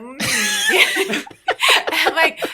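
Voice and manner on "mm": a high, exaggerated tasting hum, like a wine snob; mock connoisseur hum. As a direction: hum way up high with fake gravity over the coffee. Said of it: "doing this whole thing ... seriousness to the taste".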